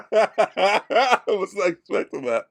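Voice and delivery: high, breaking up with laughter; laughing hard. Real, not acted. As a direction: get it out through real laughter. Still laughing at the impression.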